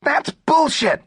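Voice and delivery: high, slow squeaky shout. A narrator's reading. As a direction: yell it high and drawn out. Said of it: "he shouted".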